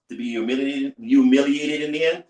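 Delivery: ordinary delivery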